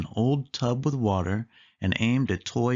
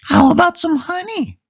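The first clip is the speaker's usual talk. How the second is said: very high, louder squeaky mouse voice; Minnie voice; 10 dB louder than usual. A narrator's reading. Minnie suggests honey for the soup, loud high squeak. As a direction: call it out high and bright. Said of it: "said Minnie".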